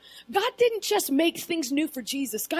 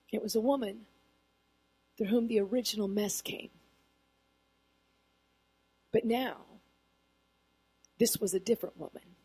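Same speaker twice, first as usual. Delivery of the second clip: soft, low musing; quiet thoughtful sermon voice; hushed reflective preaching; 5 semitones lower, 7 dB quieter than usual. Acted. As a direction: pull the room in close.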